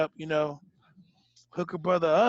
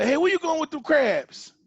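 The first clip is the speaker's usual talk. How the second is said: raised high, loud accusation; loud accusing call-out; 10 semitones higher, 6 dB louder than usual. Acted. Host mock-confronting a cheater on his show, loud and accusing. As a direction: call out the scammer. Acted scammer confronting scene.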